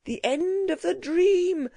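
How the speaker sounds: high, slow awestruck exclamation; gasped. A narrator's reading. Gentleman watches the city's end, gasping. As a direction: draw it out, pitched high in wonder. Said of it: "gasped the learned gentleman".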